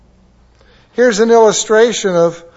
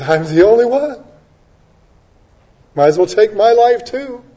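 higher, complaining voice of a despairing prophet; whining Elijah; 7 semitones higher, 4 dB louder than usual. Retold or imitated. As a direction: moan it like a self-pitying Elijah.

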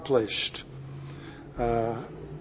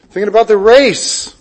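"race" swelling high and loud; stress on RACE; 5 semitones higher, 8 dB louder than usual. Shown as a demonstration. Preacher voicing Paul looking back, strong stress on "race". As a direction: lift and punch "race". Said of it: "the emphasis is on".

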